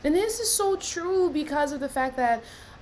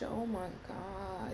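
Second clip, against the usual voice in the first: much softer, lower, almost breathed; hushed awe; 7 semitones lower, 12 dB quieter, slower than usual. Real, not acted. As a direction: drop to a near whisper. Stunned by three years' silence.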